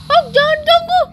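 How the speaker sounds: high, put-on cartoon voice; a petulant little creature protesting; squeaky character voice. Cat character whines at kids not to bother.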